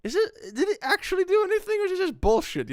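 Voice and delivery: very high, loud baffled questioning; confused viewers. Retold or imitated. Viewers ask, baffled, in a very high loud voice. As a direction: puzzle over the vague video. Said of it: "so people are like".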